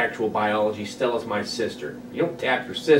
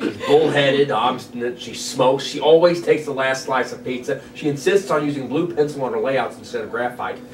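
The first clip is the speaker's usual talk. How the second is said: usual speaking voice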